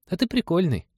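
put-on high-pitched child voice; little boy. A narrator's reading. Boy compliments pilot before sleep, high child voice. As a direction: declare it like a small boy. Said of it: "напоследок заявил мальчишка".

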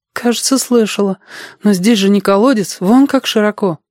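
put-on high-pitched girl's voice; doubting girl. A narrator's reading. Girl character doubts boy's explanation. (сомневающаяся девочка, высокий голос)

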